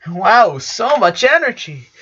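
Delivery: high, lightly voiced boy; pleased, a bit overwhelmed; Lincoln's voice. A narrator's reading. Brother hugged by twins, high amused voice.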